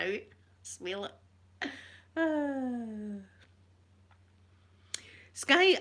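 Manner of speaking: ordinary talk, nothing marked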